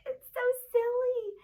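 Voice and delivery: high, fast giggly squeal; squealing excitement. Acted. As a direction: squeal it like a delighted kid. Pig sound silly.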